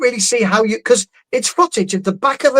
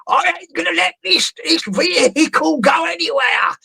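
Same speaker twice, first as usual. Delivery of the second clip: higher-pitched, hammed-up promoter voice, shouting at the peak; Eddie Hearn impression; 7 semitones higher than usual. Mocking the promoter blocking the ambulance, hammed-up high shout. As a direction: play a stubborn boss refusing to budge, half-yelling. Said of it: "it's a fairly good impression".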